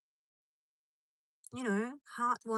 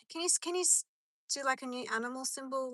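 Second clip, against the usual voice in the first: ordinary talk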